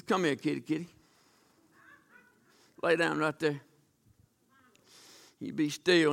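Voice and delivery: soft, low coaxing of a cat; coaxing softly. Acted. Daniel coaxing the lions to lie down, soft and low. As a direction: lull the big cats down, low and soft.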